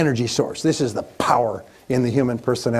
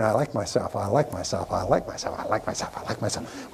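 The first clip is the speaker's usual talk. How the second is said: lower, softer each time, ending in a whisper; fading to a whisper; 5 semitones lower, 7 dB quieter than usual. Shown as a demonstration. Repeating the affirmation to himself, fading from soft speech into a whisper. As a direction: let the repetition sink inward until it is only breathed. Repeating until it fades inward.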